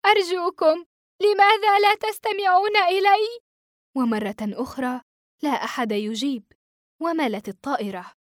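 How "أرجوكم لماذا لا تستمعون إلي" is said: high, loud pleading call; weak cry. A narrator's reading. Exhausted boy pleading with the silent cockpit. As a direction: beg them, worn out and hoarse-throated. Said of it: "فصحت في وهن".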